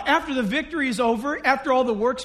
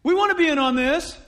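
louder, whiny gripe of a latecomer; mock complaining tribe; 5 semitones higher, 5 dB louder than usual. Ephraim complaining to Gideon about missing the call, whiny. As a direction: play the sulky tribe wanting in. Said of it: "they're gonna complain about it".

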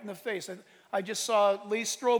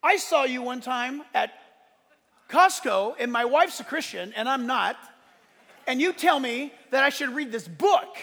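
higher, louder retold voice; stranger's story; 4 semitones higher, 6 dB louder than usual. Stranger telling pastor about Costco, loud. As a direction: tell it like the big guy. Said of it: "And he goes".

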